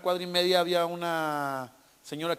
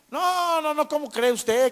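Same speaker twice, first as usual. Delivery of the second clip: high-pitched, louder mock outrage; indignant refusal; 11 semitones higher, 5 dB louder than usual. House seller turning down the buyer's offer, acted indignant refusal. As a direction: imitate a scandalized seller, long high "no".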